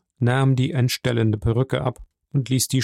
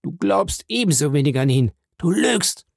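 higher, strained dying man; dying Caderousse; 6 semitones higher than usual. A narrator's reading. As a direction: spit the accusation upward.

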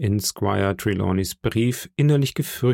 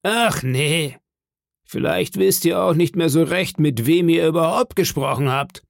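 higher-pitched needling landlord voice; Silver, sarcastic interrogator; 6 semitones higher than usual. Acted. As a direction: needle the sailor for an answer.

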